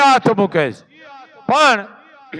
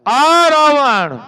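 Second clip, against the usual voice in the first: slow, higher, louder proclamation of આ Ravan; emphatic exclamation; 6 semitones higher, 4 dB louder, much slower than usual. Real, not acted. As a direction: stretch આ Ravan out loud to the hall. Preacher exclaims about Ravana. (ધીમો, ઊંચો ભારપૂર્વક ઉદ્ગાર)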